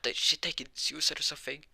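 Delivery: high, loud shocked gasp of words. Retold or imitated. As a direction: react in alarmed disbelief. Mother shocked at window jump.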